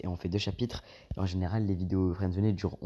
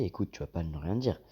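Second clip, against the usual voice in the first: ordinary talk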